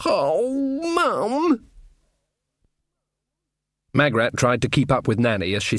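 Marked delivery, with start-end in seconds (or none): 0.1-1.7 high, plaintive son's whine; whining protest. A narrator's reading. Sean gives in to his mother with a high whine.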